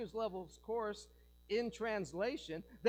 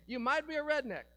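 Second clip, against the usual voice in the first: Jeff Foxberry's shtick; 7 semitones higher, 6 dB louder, faster than usual. Retold or imitated. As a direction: land the catchphrase high and loud. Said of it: "Jeff Foxberry's old shtick".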